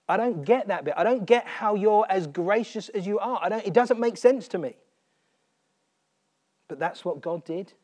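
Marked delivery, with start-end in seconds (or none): 0.1-4.8 louder, bewildered plea to God; louder pleading protest. Real, not acted. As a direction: push it out in honest disbelief. Repeats the line with force.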